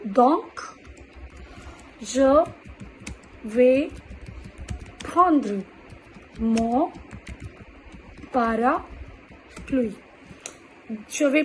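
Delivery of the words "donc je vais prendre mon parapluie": word by word, drawn out at the end; slow dictation. Shown as a demonstration. Teacher dictating an example sentence word by word. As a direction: dictate slowly, stretching parapluie. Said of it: "what they will do?".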